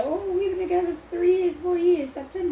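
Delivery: high, loud mock-gushing couple; mocking girly voice. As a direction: squeal it like a bragging girlfriend. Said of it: "and be like".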